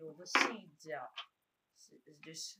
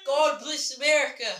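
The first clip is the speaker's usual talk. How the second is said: loud toast; 8 semitones higher, 13 dB louder than usual. Acted. Toast before drinking lemon juice.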